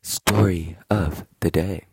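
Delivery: loud, slow show-segment intro; announcer intro. Acted. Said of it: "lead into the story time".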